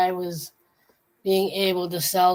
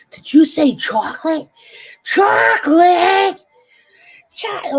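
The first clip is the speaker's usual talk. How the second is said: high, loud, slow, unhinged shrieking; crazed chocolate guy; 11 semitones higher, 9 dB louder, slower than usual. Retold or imitated. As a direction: build into a mad scream of chocolate. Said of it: "And the guy goes".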